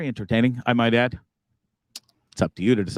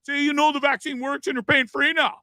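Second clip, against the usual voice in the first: mocking friend; 13 semitones higher than usual. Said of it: "And he's like".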